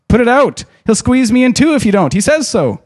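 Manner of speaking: high panicked pleading; a frightened scream that pleads; desperate cry. Acted. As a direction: beg them in terror from inside the tree. Merry trapped, crying for help.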